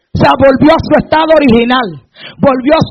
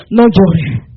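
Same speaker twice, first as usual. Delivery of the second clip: gentle comfort; 9 semitones lower than usual. Retold or imitated. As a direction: soothe a weeping mother gently. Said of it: "Me parece ver a la viuda". (consuelo tierno y suave)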